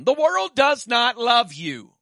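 loud, slow, high preaching declaration; preaching shout. Real, not acted. Preacher warns the congregation, slow loud declaration. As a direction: hammer it home to the church.

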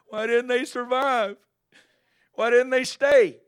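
high, loud, slow whiny lament; mock wailing complaint. Preacher mocking members upset that visitors left, wailing. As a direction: wail it like a hand-wringing churchgoer.

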